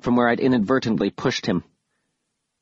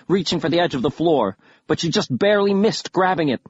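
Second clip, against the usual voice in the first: ordinary delivery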